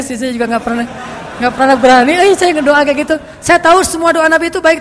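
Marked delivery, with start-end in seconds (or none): 1.4-4.8 animated confession. Real, not acted.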